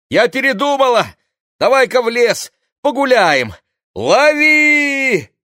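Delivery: high, loud, excited calling. Acted. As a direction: shout it down from above. Rosa calls down from window.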